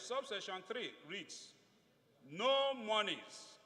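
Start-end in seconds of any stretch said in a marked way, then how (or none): 2.3-3.6 a slow, deliberate stress on No. Shown as a demonstration. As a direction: stretch out No monies, weighting No. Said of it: "emphasis again on no".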